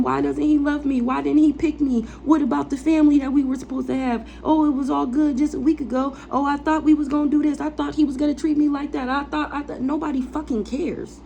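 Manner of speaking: mock the clingy jilted woman she refuses to be; mock heartbroken whine. Mocking how the ex wanted her to feel, in a high whiny voice. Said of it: "make me be conflicted and in my emotions".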